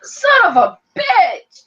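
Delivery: high, loud, slow drawn-out curse; mock outburst. Ron cursing openly in the movie, loud slow curse. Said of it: "instead of being like".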